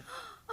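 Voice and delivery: sharp high intake of breath; shocked gasp. Acted. Narisha seeing her husband's wound, shocked gasp. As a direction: gasp at the sight of blood. Narisha sees Clancy's blood.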